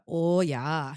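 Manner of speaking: a hint of Midwest accent, long O; drawn-out Minnesota-style oh yeah. Shown as a demonstration. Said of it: "I can bust out".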